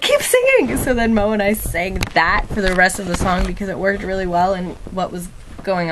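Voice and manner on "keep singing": very high, eager call to the crowd; excited call. Retold or imitated. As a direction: cheer them on, very high. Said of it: "she's like".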